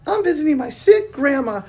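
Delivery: put-on high sweet child voice; little girl voice. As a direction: explain brightly like a little girl.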